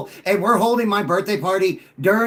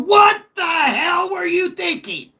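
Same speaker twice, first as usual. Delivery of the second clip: much higher, slow mocking wail; hammed-up fan crying out in disbelief; mock outraged Vikings fan; 11 semitones higher, slower than usual. Caller imitating Minnesota fans at the Viking ship, high slow mock outrage. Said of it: "ship and going".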